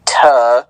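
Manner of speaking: "tuh" sounded out on its own; syllable demo. Shown as a demonstration. Breaking the name down for co-host, syllable demo. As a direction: say a short, separate "tuh". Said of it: "It's literally".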